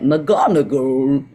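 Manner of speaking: slow, drawn-out Sorting Hat proclamation; drawn-out magical hat announcement. Retold or imitated. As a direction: call out the house like the Sorting Hat. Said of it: "it was a Sorting Hat".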